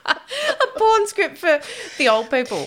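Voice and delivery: real laughter breaking each word; laughing at her own joke, slow. Real, not acted. Joking about the nursing home visit.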